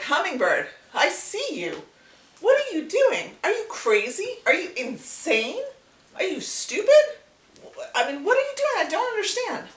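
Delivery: high, loud, exasperated monkey voice; incredulous monkey. Acted. Monkey scolding hummingbird, high and loud disbelief. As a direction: scold the little bird as a baffled monkey.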